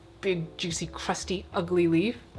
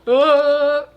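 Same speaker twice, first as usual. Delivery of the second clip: startled cry; 8 semitones higher, 10 dB louder than usual. Real, not acted. Startled by falling plant.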